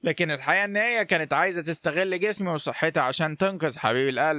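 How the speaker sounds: usual speaking voice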